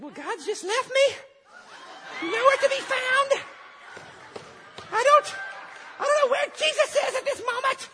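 very high, loud mock wail; whiny despairing victim. Preacher voicing Josh feeling God left him, high mock whine. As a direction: squeal out the self-pity in a put-on tiny voice. Acting out Josh's despairing complaint.

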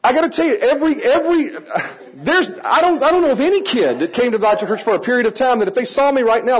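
ordinary talk

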